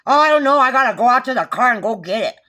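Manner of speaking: loud, high mock of a flustered excuse; hammed-up dodge from the cop; mocking the officer. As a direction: parrot his lame excuse, loud and high. Officer's retold evasive answer.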